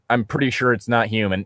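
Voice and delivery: usual speaking voice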